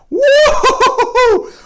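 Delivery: high, laughing woo-hoo after the sniff; excited whoop. Real, not acted. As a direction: whoop with delight like the smell knocked you back. First sniff of potent gin.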